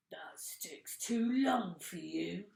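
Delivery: slow, breathy sly whisper of a nasty husband. A narrator's reading. Mr Twit teasing his wife in a sly whisper. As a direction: hiss it slyly, savouring the trick.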